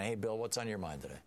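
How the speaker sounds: normal talking voice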